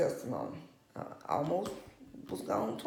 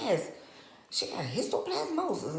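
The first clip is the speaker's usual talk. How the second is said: excited doctor; 7 semitones higher than usual. Retold or imitated. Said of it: "He was just excited".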